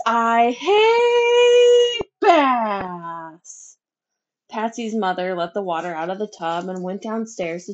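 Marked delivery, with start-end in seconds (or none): 0.0-3.8 slow, high, sing-song crying wail; wailing sob. A narrator's reading. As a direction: let it dissolve into a drawn-out sobbing howl. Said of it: "piercing shriek".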